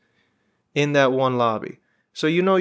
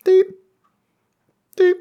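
imitated beep; 5 dB louder than usual. Mimicking turnstile rejecting his ticket.

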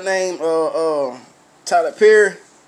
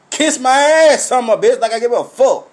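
higher, louder dismissal; defiant shout; 4 semitones higher, 6 dB louder, faster than usual. Real, not acted. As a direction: tell the offended viewers off. Defiant rant at offended viewers.

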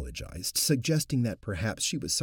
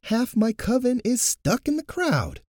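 higher, louder frustrated protest; frustrated shout; 8 dB louder than usual. Acted. High priest answering Luke over the crowd, frustrated shout. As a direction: shout it out, exasperated. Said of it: "the high priest shouted in frustration".

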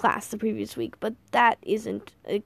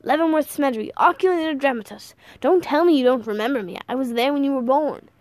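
put-on higher, louder eccentric old man; exuberant grandfather; 4 semitones higher, 5 dB louder than usual. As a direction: announce yourself with boisterous familiarity.

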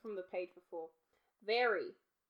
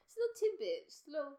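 silly voice; 13 semitones higher, 5 dB louder, faster than usual. Pretend. Silly sing-song voice.

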